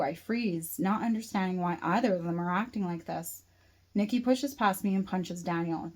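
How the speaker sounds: usual speaking voice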